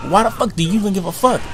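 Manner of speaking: normal talking voice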